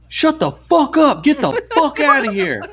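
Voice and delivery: high, slow, drawn-out mock yell; imitated yelling. Stranger yelling at the crazy customer, high mock yell. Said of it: "starts yelling at this dude".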